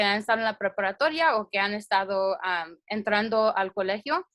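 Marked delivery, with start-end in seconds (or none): none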